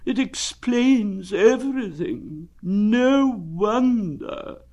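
high, slow gloomy donkey voice. Acted. Eeyore gloomily accepting his lost tail. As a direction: say it like everything proves the worst.

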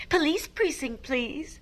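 high, dainty telephone request; sing-song storybook politeness; very polite. Shown as a demonstration. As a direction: ask the operator ever so politely. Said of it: "Can you say very politely".

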